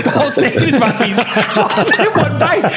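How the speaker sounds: telling it while cracking up; high giggling storytelling; laughing. Real, not acted.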